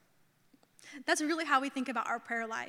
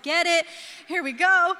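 louder, raised-pitch cheer; hyped up; 4 semitones higher, 7 dB louder than usual. Retold or imitated. Woman at home chilling with TV, hyped up. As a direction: cheer on the show with relish. Relaxing at home, hyping TV show.

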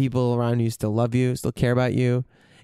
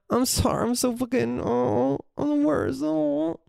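high, whiny wail of self-pity, much higher; self-pitying whine; 10 semitones higher than usual. Retold or imitated. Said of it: "Shame is like".

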